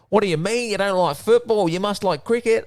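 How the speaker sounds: high-pitched mock disbelief; incredulous Aussie. Aussies quizzing a Brit about football, high mock-incredulous voice.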